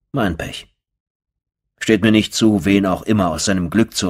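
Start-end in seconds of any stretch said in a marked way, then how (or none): none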